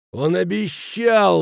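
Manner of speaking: high, drawn-out angry roar. A narrator's reading. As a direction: roar the accusation slowly. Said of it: "взревел Кранер". (гневный рёв)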